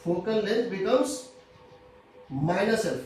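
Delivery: slow, drawn-out dictation with a pause; measured classroom dictation, very slow. Real, not acted. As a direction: dictate slowly for note-takers. Teacher dictating a rule slowly.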